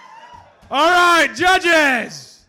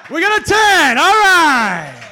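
excited shout; 6 semitones higher, 9 dB louder than usual. Real, not acted. Host announcing a perfect score.

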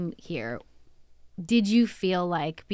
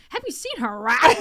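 lightly voiced guy ogling, a bit higher; imitating leering guy; 4 semitones higher than usual. Imagined guy in the car remarking on a woman, lightly imitated. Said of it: "imagine a guy was in the car".

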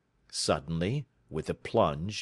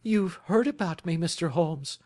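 cried out; 10 semitones higher than usual. A narrator's reading. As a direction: blurt it out in alarm. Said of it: "she cried".

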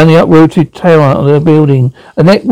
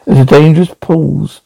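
ordinary delivery

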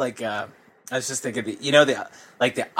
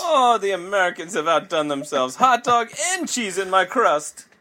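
higher Italian voice, lightly done; jolly italian pizza chef; 5 semitones higher than usual. Acted. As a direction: marvel like an Italian. Italian voice praising American pizza.

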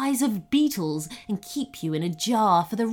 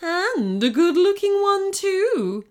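high, smug goblin queen; villain's smug compliment, pitched up; smirking; 10 semitones higher, 4 dB louder than usual. A narrator's reading. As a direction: purr it admiringly with a smirk. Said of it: "smirked the Goblin Queen".

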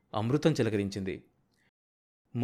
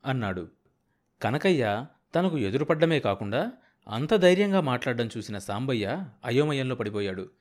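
ordinary delivery, nothing marked

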